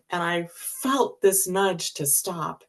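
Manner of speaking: ordinary delivery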